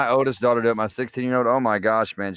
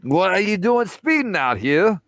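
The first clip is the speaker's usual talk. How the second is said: mock British cop, pitched higher; British accent; 8 semitones higher, 3 dB louder than usual. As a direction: play a British cop scolding a speeding driver. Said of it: "the British accent and all".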